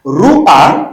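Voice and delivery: loud "Ruach", a sounded before the guttural ch; furtive patah demo. Shown as a demonstration. Teacher models for the class, loud "Ruach" with a before ch. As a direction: model "Ruach" firmly, ending on a throaty ch. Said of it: "Vous prononcez d'abord les A".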